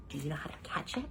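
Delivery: soft spooky whisper. Acted. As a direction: breathe the question.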